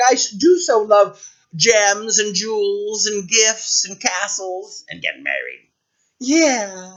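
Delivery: high, gushing royal voice; dreamily covet treasures; spoiled princess, longing. Acted. Princess longs for riches.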